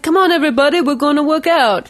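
put-on high, loud Austrian-accented gym shout; Arnold Schwarzenegger impression. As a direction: pump up the room in an Austrian accent. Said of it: "That's my Arnold Schwarzenegger".

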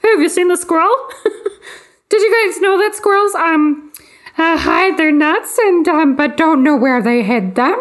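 high, excited, dreamy rambling; mock giddy late-night texter. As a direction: gush about squirrels like a giddy insomniac. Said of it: "More like".

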